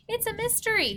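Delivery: put-on light high little-girl Annie voice; voicing Annie.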